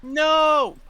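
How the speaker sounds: loud, high, drawn-out no; loud protest. Real, not acted. Player objecting at the game table, loud long "no". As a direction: cry out in protest.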